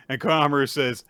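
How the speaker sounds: normal talking voice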